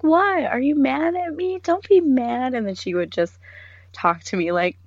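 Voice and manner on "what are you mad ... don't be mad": high, pouty pleading voice; mock pleading coworker; whiny plea. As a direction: beg not to be mad.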